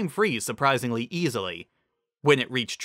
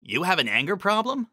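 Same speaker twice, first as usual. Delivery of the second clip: Lyra's voice; 8 semitones higher than usual. A narrator's reading. Lyra voiced.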